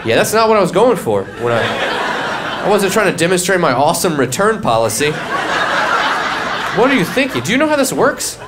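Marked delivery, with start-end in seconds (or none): 5.1-8.4 high-pitched disbelief; incredulous. Real, not acted. Comic asking his clueless friend how this works, high and incredulous. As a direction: pitch way up in mock outrage. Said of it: "mock disbelief at friend".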